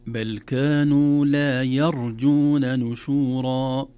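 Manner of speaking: loud, slow, drawn-out recitation; Quran recitation. Shown as a demonstration. Teacher modelling the next verse, loud slow recitation. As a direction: recite strongly with long vowels.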